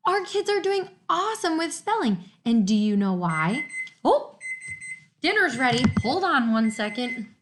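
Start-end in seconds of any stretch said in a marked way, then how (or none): 4.0-7.3 surprised aside. Real, not acted. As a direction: catch yourself as dinner is called. Sudden aside to household.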